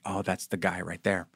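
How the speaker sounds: low, hushed imitation of gossiping onlookers; soft, furtive aside; mock whispering. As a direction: drop it down like people murmuring behind your back. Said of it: "elbowing each other and whispering like".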